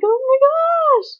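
excited squeal. Retold or imitated. Thrilled about the first day of fashion school, squealing high and long. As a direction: gush it out giddy with excitement. Her own excited exclamation.

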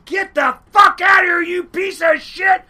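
furious high-pitched screamed order; screaming. Retold or imitated. Lady running out of the house at him, screaming. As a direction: scream it at full force, very high. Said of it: "she starts screaming like hell".

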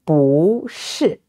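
"不" rises, then "是" falls; changed second tone. Shown as a demonstration. Teacher modelling the easier tone change, "不" rising before falling "是". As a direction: lift "不", then drop "是". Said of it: "changed into a second tone".

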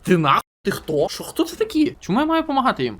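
high, loud incredulous squeak; shocked high protest; indignant surprise. Real, not acted. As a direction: shriek in disbelief. Shocked by strangers.

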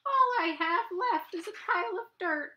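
high, moaning rabbit voice; moaned Rabbit. Acted. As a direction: moan it sadly as Rabbit. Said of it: "moaned Rabbit".

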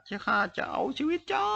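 high, drawn-out enraged scream; furious. A narrator's reading. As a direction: stretch the threat into a high-pitched yell. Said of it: "ด้วยน้ำเสียงเกรี้ยวกราด".

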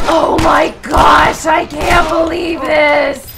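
loud, incredulous outburst; exasperated yelling. Real, not acted. Gamer scolding character who chooses now to reload, exasperated yelling. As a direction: shout in disbelief at a useless teammate.

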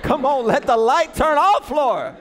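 high, shouted plea to heaven; hammed-up shouted prayer; desperate praying. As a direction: beg loudly in mock desperation. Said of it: "So I'm praying".

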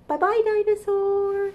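high sing-song farewell; cheery goodbye. Acted. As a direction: wave the dinosaur off brightly.

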